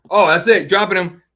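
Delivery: excited shout. Real, not acted. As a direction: explode with the call. Second knockdown called live.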